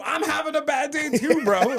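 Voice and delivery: retold exasperated retort. Answering attacker's excuse, high exasperated retort. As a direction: throw it back in comic outrage.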